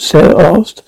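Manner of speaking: ordinary delivery, nothing marked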